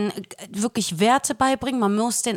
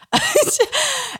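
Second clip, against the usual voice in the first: laugh. Real, not acted. Retelling being pinched.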